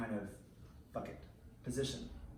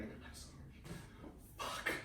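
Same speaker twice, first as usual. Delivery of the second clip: whispered apology; 12 dB quieter, slower than usual. Retold or imitated. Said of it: "I was like".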